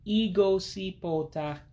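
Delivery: Igosipota slow and high, ee-go-see-pol-tah; special-character pronunciation. Shown as a demonstration. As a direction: model Igosipota slowly, syllable by syllable. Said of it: "already know what the pronunciation is".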